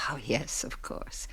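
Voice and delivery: whispered aside. Acted. Mother humours child's game.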